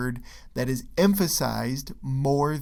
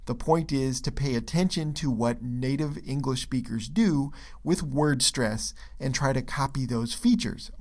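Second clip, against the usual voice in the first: normal talking voice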